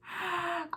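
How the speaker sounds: a short, high burst of laughter; high giggle. Real, not acted. Reviewer giggling at the movie's message, high laugh. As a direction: giggle in disbelief at the film.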